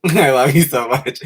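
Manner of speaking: loud gushing love after a laugh. Real, not acted. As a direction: burst out loving a fan. Thanks a longtime supporter.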